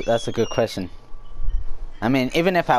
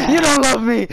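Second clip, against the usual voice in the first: high, hammed-up hurt cry; mock tearful tantrum; 7 semitones higher, 4 dB louder than usual. Friend replaying son's outburst at mom, high mock wail. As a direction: wail the accusation, pretending to cry.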